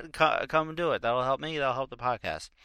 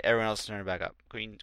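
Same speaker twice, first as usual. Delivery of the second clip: ordinary talk, nothing marked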